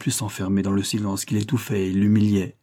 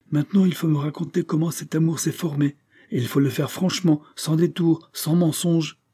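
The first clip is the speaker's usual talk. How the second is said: stern demand; 7 semitones higher than usual. A narrator's reading. Father demanding the truth.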